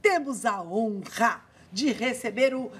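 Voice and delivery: drawn-out sports-show announcer delivery; punchy, ceremonious host intro with slow emphasis; TV presenter introduction. Acted. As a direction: introduce the guest with fanfare, stretching key words. Host's show opening and guest introduction.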